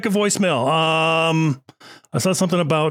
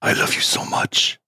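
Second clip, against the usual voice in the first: whisper. Acted. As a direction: breathe it to the food like a lover. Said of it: "I whispered the words".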